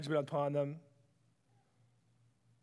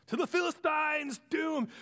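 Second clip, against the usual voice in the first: higher, booming doom call; 8 semitones higher than usual. Acted. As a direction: announce doom like a prophet. Prophet pronouncing doom.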